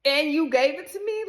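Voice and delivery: high, loud, incredulous question; retold accusation. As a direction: throw it at him as an outraged accusation. Imagined confrontation with the man.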